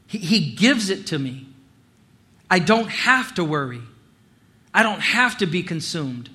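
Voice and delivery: usual speaking voice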